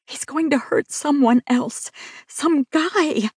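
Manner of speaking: pleading with the skeptical cop. Acted. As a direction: insist he'll hurt someone. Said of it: "I pleaded".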